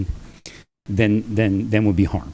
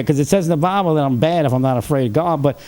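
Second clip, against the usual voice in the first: ordinary talk, nothing marked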